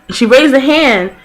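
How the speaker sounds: usual speaking voice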